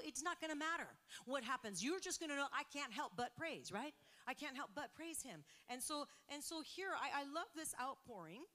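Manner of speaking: loud, rising preacher's call; preaching at full force. Acted. Pastor rallying the congregation to praise, loud and rising. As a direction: lift the room with every line.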